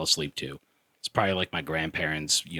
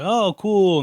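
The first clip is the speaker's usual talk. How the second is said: much higher and louder, overdone delight; mock excited; 11 semitones higher, 7 dB louder than usual. Son answering his dad's birthday remark, mock thrilled. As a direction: fake it like the news thrills you. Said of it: "And I was like".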